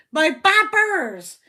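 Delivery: loud, slow Bristolian drawl; Bristolian accent. Shown as a demonstration. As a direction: stretch it out in broad Bristolian. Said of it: "In a Bristolian accent".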